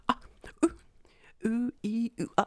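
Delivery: high, halting false-start vowels; mock tongue-tied "ah, ooh, ee" noises; flustered stammering noises. Two men unsure which language to start in, mock flustered stammer.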